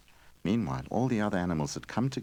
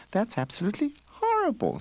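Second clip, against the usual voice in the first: shy hedgehog. A narrator's reading. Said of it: "said the shy hedgehog".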